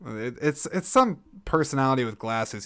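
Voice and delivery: ordinary talk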